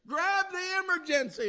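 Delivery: urgent, high, repeated cry; frantic high call to grab the brake; excited shout. Retold or imitated. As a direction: yell it in a panic to the old lady. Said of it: "he was all excited".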